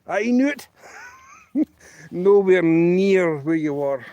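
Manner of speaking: loud, high-pitched gotcha; mock indignant triumph. Joke caller catches the bank lass lying, loud mock outrage. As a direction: crow it loudly, caught them out.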